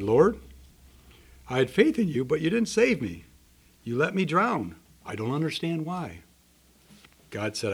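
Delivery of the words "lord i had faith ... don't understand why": pleading protest. Acted. Drowned man protesting to God.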